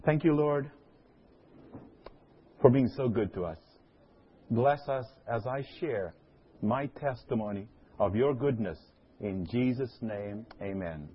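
soft, quiet prayer voice. Real, not acted. Opening prayer.

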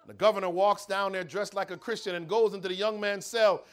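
normal talking voice

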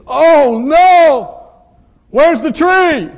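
high, loud cry of dismay; shocked old grandfather. Retold or imitated. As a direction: shout it out, heartbroken at the missing tree. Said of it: "and he goes".

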